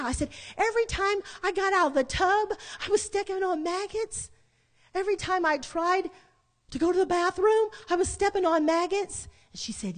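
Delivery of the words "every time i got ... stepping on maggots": high-pitched, mounting incredulous questions; horrified disbelief. Retold or imitated. Woman questioning sister about stepping on maggots, horrified disbelief. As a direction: re-enact being appalled, voice held high.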